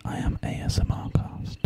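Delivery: ordinary talk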